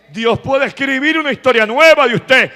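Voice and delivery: high, sustained shouted sermon; preaching at full force. Real, not acted. Preacher urging congregation to give glory, full-force preaching. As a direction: drive the congregation up to applause and praise.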